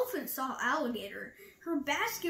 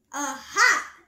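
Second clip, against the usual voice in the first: loud, sudden exclamation; Rabbit's triumphant aha; 11 dB louder than usual. A narrator's reading. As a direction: pounce on it loudly, as if catching someone out. Rabbit catches Elephant out.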